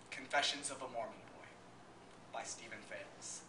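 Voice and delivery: low, soft programme reading; formal introduction. Acted. Presenter introduces the play and its author, low and formal. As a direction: read it evenly like a quiet prologue.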